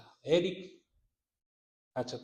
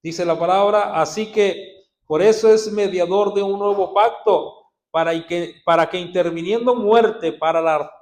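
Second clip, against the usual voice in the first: loud, driving pulpit reading; preaching at full force; 3 semitones higher, 11 dB louder than usual. Acted. Preacher proclaiming Hebrews to the church, loud and fervent. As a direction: hammer each phrase out to the crowd.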